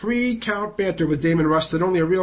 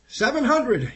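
a higher, lightly yelped number; 7 semitones higher, slower than usual. Retold or imitated. Reporter voicing the crowd at seven hundred, mild yelp. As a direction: yelp the milestone out. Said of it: "yelps getting louder".

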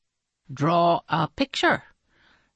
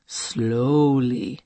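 very slow, lowered close to a tale; slow story ending; 4 semitones lower, much slower than usual. A narrator's reading. Narrator winding a story down, slowly, drawn out. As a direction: draw every word out slowly.

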